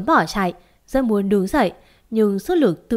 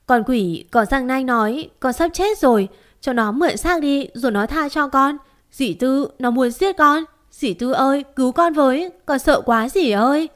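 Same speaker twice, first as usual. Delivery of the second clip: much higher, rushed and frantic; panicked; 4 semitones higher than usual. Acted. To aunt Tư, panicked and begging for rescue. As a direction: blurt it out in terror. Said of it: "Tôi hoảng loạn nói".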